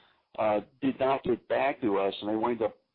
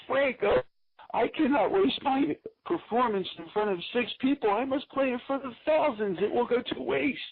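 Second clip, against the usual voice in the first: hammed-up Italian accent, pitched up; a sax player's jokey Italian accent; 11 semitones higher than usual. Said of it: "in sort of an Italian accent".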